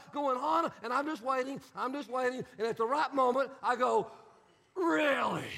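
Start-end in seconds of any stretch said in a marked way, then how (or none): 4.8-5.4 a pointed, disbelieving really; sarcastic really. Retold or imitated. Youth pastor answering a complaining teen, sarcastic. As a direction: cut the rant short with sarcasm.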